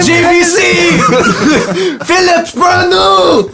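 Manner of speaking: high, loud, laughing pitchman yells; manic shouting. Pretend. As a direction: yell out the brands like a madman. Acting Gideon throwing VCRs.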